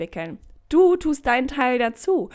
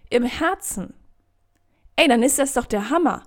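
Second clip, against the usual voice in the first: normal talking voice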